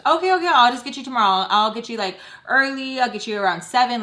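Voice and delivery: loud, high, fast mock dodging; hurried brush-off. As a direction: imitate mom rushing past the question. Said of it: "She kept avoiding the freaking answer".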